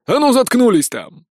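barked shout. A narrator's reading. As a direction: yell them into silence. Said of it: "гаркнул шериф". (громко гаркнуть приказ)

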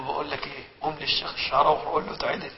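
quiet presidential aside; discreet aside. Retold or imitated. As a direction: say it softly so no one else hears. Sadat tells prime minister discreetly.

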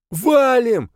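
high, loud cry to run; panicked shout. A narrator's reading. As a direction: yell and bolt for the gate. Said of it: "закричал Беззубый". (кричит в панике)

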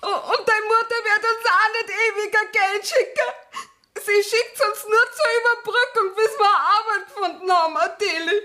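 high-pitched, upset pleading; tearful protest. Acted. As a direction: plead through tears, pitched well up. Child tearfully confronts Adele about money. (weinerlich klagend, mit hoher Stimme)

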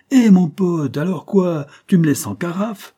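put-on high, loud kid's holler; street kid calling out. A narrator's reading. Street kid calling his pal to come play. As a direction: call out like a cheeky Paris street kid. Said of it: "La voix du môme réglisse retendit".

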